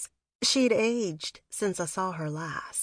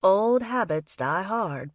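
ordinary delivery, nothing marked

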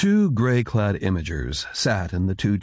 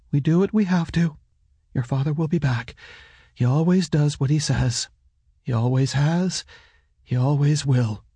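higher-pitched woman's voice, steady insistence; reassuring mother; 6 semitones higher than usual. A narrator's reading. Mother assures son father returns, higher steady voice.

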